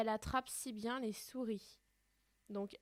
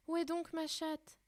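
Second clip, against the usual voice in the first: higher, lightly voiced girl asking; Alice calling; 7 semitones higher, 4 dB louder than usual. Alice wondering aloud about her cat, higher. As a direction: ask it up high like a little girl.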